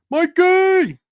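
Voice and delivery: high, loud shout of a name; excited call-out. Real, not acted. Streamer hailing Mikey as he joins, excited call-out. Greets a viewer joining the stream.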